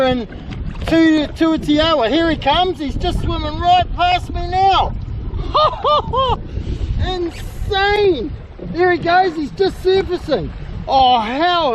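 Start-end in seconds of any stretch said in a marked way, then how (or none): 5.0-7.0 bright burst of laughter at the whale; delighted high laugh. Real, not acted. Kayaker sees whale pass, delighted high laugh.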